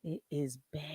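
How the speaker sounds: very soft, low aside; hushed dismay. Real, not acted. Reacting to a bad speech, hushed dismay. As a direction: drop to a near whisper in dismay.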